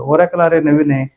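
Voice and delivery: ordinary talk, nothing marked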